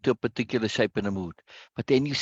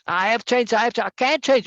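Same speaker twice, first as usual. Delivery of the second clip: excited self-talk; 9 semitones higher, 4 dB louder than usual. Shown as a demonstration. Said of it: "this emphasis".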